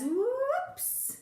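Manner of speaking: surprised high whoops; a playful little slip; mock alarm, high. Running back downstairs.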